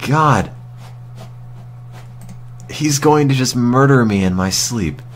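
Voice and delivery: normal talking voice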